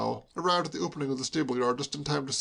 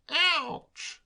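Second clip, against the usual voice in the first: high, sharp yelp of pain; a sudden cry floating back; agonized ouch. A narrator's reading. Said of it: "a single agonized".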